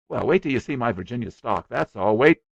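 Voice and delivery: put-on boy bragging to a guest, eager; eager small boy. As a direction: rattle on like a boastful little boy.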